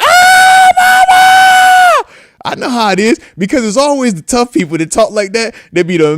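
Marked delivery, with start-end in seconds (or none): 0.0-2.1 high, loud rollercoaster scream; mock terrified scream. Host mimicking a tough guy on the roller coaster, screaming. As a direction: scream for mama at full pitch. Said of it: "you be up there screaming".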